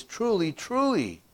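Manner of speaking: "Truly, truly" both pitched high with a falling stress; emphatic truly. Shown as a demonstration. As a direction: hit each "truly" high and hard. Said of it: "even more emphatic is when he says".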